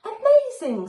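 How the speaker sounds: high, loud delighted cry; amazed. A narrator's reading. Betty watching her cauldron work, amazed cry. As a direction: gasp out your delight. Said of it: "said Betty".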